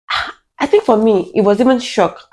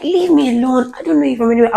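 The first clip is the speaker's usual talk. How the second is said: retold shouting; 7 semitones higher than usual. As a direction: cry out for attention without full volume. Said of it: "like, shouting just so that people".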